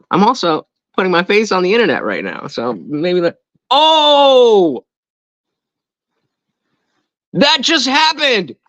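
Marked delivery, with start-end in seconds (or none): 3.7-8.6 startled high-pitched exclamation; shocked outcry. Real, not acted. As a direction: cry out high, stretching the words. Sudden reaction mid-sentence.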